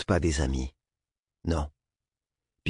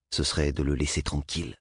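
usual speaking voice

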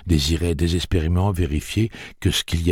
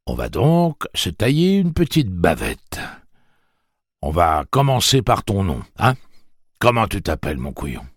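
higher, louder jovial taunt; mock-friendly menace; 5 semitones higher, 6 dB louder than usual. A narrator's reading. Tic-Tac questioning Jake, jovial menace. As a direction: play the friendly host.

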